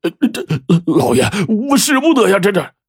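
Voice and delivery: high stammering plea; a kneeling servant stammers, begging his master; frightened pleading. Acted. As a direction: beg on your knees, stuttering.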